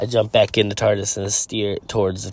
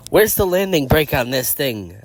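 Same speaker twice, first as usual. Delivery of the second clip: higher-pitched yell, not full volume; mild yell; 6 semitones higher, 3 dB louder than usual. Acted. Struggling with a plummeting tardis, mild yell. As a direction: half-shout it in alarm. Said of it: "I yell".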